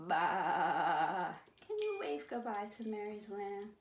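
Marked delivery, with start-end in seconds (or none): 0.1-1.3 long, loud, wobbling "baa"; sheep bleat. Pretend. Host ending Mary's lamb song, loud wobbly bleat.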